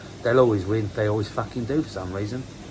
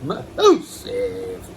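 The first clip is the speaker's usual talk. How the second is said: Massive in a squealing falsetto, much higher; squeaky high massive; 3 dB louder than usual. Acted. Koi keeper boasting about his fish, squealing high. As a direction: squeal Massive like an excited kid. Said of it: "repeat with contrast".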